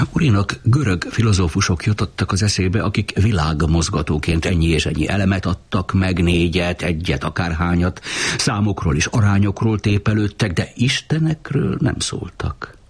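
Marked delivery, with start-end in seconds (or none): none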